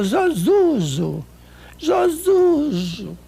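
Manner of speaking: imitating a speech-impaired friend saying Zozuzo; imitating slurred speech. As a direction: stretch Zozuzo out like a tongue that won't obey. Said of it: "Ela falou".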